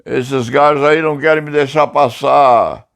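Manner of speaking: high-pitched calling out for help; retold shouting. Blocked by soldiers, calling to his neighbour the president, retold shouting. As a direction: imitate yelling to a neighbour. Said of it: "E aí começou a gritar".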